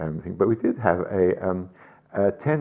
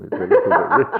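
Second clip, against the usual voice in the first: laughing; 13 semitones higher, 4 dB louder, much faster than usual. Real, not acted.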